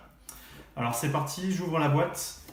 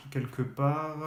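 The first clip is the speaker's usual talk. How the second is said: normal talking voice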